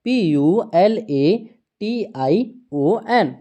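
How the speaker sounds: slow, evenly spaced letter-by-letter spelling; teacher dictating letters one at a time. Shown as a demonstration. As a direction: read each letter out slowly for the class. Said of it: "next word दिया है".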